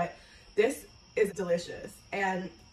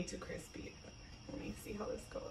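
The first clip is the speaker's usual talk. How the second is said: whispering; 14 dB quieter than usual. Real, not acted. About to taste the hot apple.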